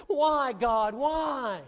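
loud, pleading cry to heaven; anguished cry. Acted. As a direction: call out to a silent God in despair. Job crying out to God.

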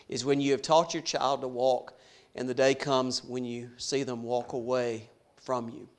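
usual speaking voice

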